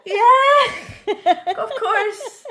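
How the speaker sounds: high, loud laughing yes of course; laughing eager yes. Retold or imitated. Agreeing to ride with the boy, laughing eager yes.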